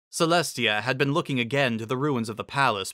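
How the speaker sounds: plain reading voice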